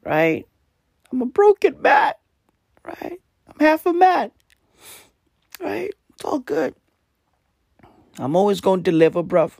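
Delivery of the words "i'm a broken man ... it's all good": high, breaking, mock-tragic voice; voice cracking. Real, not acted. Telling listeners his voice is gone, cracking high and mock-tragic. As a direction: squeeze out a worn-out voice for pity. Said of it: "my voice is gone".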